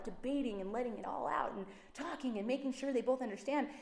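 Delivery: usual speaking voice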